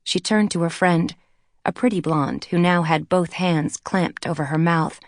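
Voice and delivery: ordinary delivery, nothing marked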